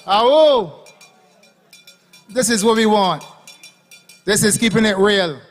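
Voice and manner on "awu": loud whooping "awu" call; one more crowd-rousing "awu"; howling like a wolf pup. Real, not acted. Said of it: "Let me get another awu".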